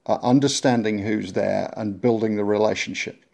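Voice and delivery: normal talking voice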